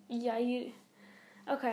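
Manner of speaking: usual speaking voice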